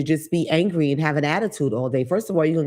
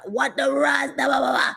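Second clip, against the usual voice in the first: high-pitched Caribbean gibberish; mock Caribbean accent; 9 semitones higher than usual. Imitating Jamaicans and Trinidadians sounding aggressive, mock Caribbean accent. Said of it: "the Jamaicans, the Trinidad".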